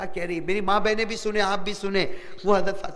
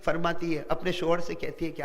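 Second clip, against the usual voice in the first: ordinary talk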